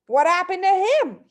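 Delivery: high, loud alarmed blurt; spluttering. A narrator's reading. Father alarmed by the superglue story, spluttering. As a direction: splutter out the question in a panic. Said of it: "he spluttered".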